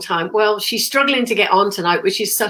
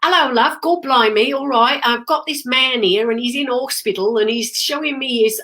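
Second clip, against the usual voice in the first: higher-pitched, chatty Cockney patter; Cockney impression; 3 semitones higher than usual. Medium mimicking her colleague on stage, Cockney accent. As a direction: put on a cheeky London Cockney accent. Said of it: "a real sort of Londony accent".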